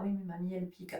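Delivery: put-on soft, meek child's complaint; small child's whine. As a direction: protest quietly like a little kid. Child protesting to parent.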